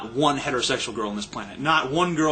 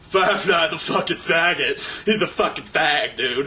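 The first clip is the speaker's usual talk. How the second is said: slow, drawn-out mocking impression of a commenter; imitating a heckler; 3 semitones higher, slower than usual. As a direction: drag out the insult as a dumb heckler. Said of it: "someone else earlier today said in one of the videos".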